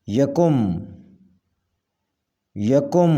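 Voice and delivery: یکم with u in the second syllable: ya-kum; correct pronunciation. Shown as a demonstration. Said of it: "صحیح تلفظ ہے". (یکم کا صحیح تلفظ، "یَکُم")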